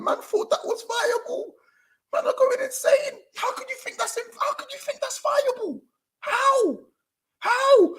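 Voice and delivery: very high-pitched disbelief; incredulous squeal. Real, not acted. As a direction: squeak it out in outraged bafflement. Outraged disbelief at the team selection.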